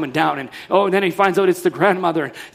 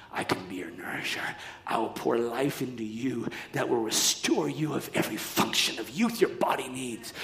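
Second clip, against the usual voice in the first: soft whispered promise; tender whisper; 7 semitones lower, 11 dB quieter than usual. Acted. God whispering comfort to tired Abraham. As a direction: breathe it gently like a comforting voice.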